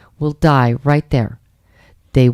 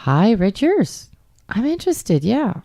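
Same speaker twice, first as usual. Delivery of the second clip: mock-bright echo of a dull message; 5 semitones higher, 4 dB quieter than usual. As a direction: mimic the lazy reply back with mock cheer. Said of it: "Okay, great".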